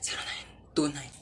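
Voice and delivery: soft breathy whisper, barely heard; whispered number. Acted. Friend whispering a phone number across the room, whispered. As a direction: whisper the digits secretly.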